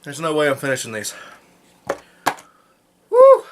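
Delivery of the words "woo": loud high whoop from burning heat; after eating wasabi. Real, not acted. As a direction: whoop out the spice burn. Burning mouth, failing challenge.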